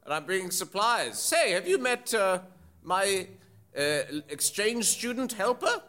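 high, proud character voice; cheery old groundskeeper. Acted. Groundskeeper bringing supplies to school, high cheery voice. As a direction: announce it as a chipper groundskeeper.

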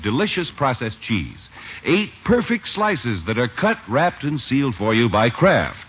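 bright, measured sponsor pitch; old-time radio commercial voice; radio ad read. Acted. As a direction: sell the cheese like a fifties announcer.

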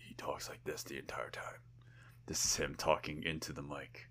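hushed, whispery tough-guy narrator; very soft, voiceless growly voiceover; low, stoic growl. Retold or imitated. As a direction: imitate a stoic action hero muttering into the mic. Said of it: "he had that low, stoic, growly voice".

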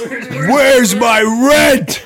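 very slow, drawn-out demand; demanding landlord. Acted. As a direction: stretch the demand out slowly. Repeating Nicolas Cage line.

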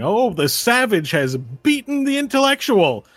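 big, high, excited announcer voice; arena announcer. Acted. Announcer hails the savage's win, grand showy announcer voice. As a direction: hype the fighter, stretching out the finish. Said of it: "you hear the voice of the announcer".

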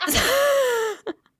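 squeaking high through laughter; helpless laughter. Real, not acted. Child hands dad another cracker, helplessly laughing.

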